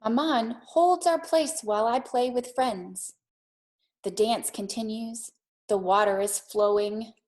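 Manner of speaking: put-on gentle read-aloud for children; calm storytelling pace for a classroom; storybook reading. A narrator's reading. Teacher reads picture book aloud.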